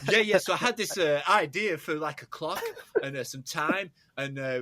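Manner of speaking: put-on high New York accent. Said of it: "your bloody Swedish accent".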